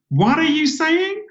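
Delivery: high, slow, baffled American waitress; confused waitress. Retold or imitated. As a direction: squeak it out, utterly lost. Waitress totally lost.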